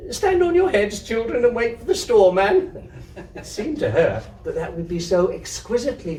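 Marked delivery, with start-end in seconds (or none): 0.1-2.9 much higher, louder playful cry; high-pitched mock order with a laugh; giddy high call. A narrator's reading. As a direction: call it out as if it's the funniest joke. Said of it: "longed to say".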